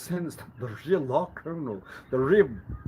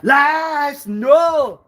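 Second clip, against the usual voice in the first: loud, higher proud boast; boastful claim; 11 semitones higher, 7 dB louder than usual. Retold or imitated. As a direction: puff yourself up and declare it loudly. Voicing a boastful reputation claim.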